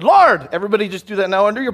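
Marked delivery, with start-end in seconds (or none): 0.0-0.5 short, high, loud call; loud cry to God. Retold or imitated. Preacher acting out Paul on the Damascus road, crying out. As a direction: call out loudly, imitating a sudden conversion. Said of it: "He just said".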